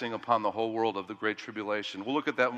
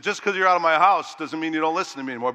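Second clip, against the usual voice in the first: fast, louder scolding of a grown son; retold scolding; 4 semitones higher, 6 dB louder, faster than usual. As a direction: tell him off quickly, irritated. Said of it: "and I was ticked".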